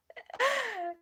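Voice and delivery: high bright laughing burst; high burst of laughter. Real, not acted. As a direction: let a high laugh break out. Laughs after strong lady.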